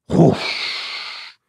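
long airy rush of flames; unvoiced whoosh like a sudden blast of fire; imitated whoosh. Preacher picturing flames bypassing you, imitated whoosh.

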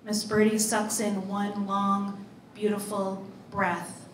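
usual speaking voice

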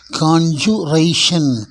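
காஞ்சுரேஷன் slowly in parts, KON-joo-RAY-shun; sounded R. Shown as a demonstration. Teacher models the noun for learners, slow with sounded R. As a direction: pronounce the R clearly in காஞ்சுரேஷன். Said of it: "அந்த ஆருக்கு உச்சரிப்பு உண்டு".

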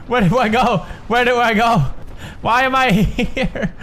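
high-pitched flustered panic, breaking into laughter; laughing panic. Real, not acted. Gamer with no idea where to go, laughing panic. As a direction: fret helplessly, half laughing. Lost while fleeing in game.

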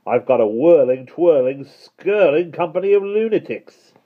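loud, rising character voice, rolling out the words; bewildered postman. A narrator's reading. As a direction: grumble loudly, building to a high finish. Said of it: "he muttered".

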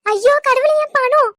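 high, loud crying out to god; grandmother's anguished loud lament; anguished wail. Acted. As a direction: wail in despair for the money. Grandmother wails as money taken.

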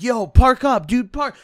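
high, loud, urgent demand; freaking out. Retold or imitated. Imitating a friend yelling at the driver, high and loud. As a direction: yell at the driver to pull over now. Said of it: "this guy's freaking out".

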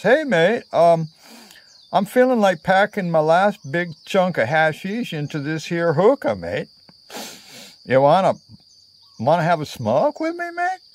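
put-on a friendly, lightly done Australian accent, high on "Hey"; an easygoing Aussie offering a smoke; warm Aussie mate. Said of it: "the Aussie warmly responds".

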